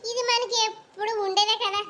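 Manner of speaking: ordinary talk, nothing marked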